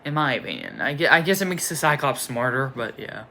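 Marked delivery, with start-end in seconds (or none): none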